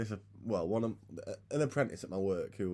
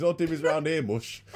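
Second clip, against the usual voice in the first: put-on old steelworker slang; 8 semitones higher, 7 dB louder than usual. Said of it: "always fucking walks around saying that".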